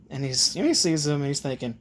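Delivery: ordinary delivery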